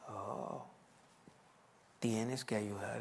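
low, soft, slow sarcastic echo; hushed sarcastic repeat; mocking echo. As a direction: repeat his words mockingly. Pastor mocks repeating him.

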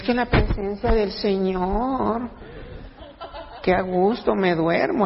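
slow, drawn-out drowsy churchgoer; mock dreamy contentment; blissful dozy churchgoer. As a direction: stretch it out as if drifting off. Mimics a churchgoer dozing blissfully.

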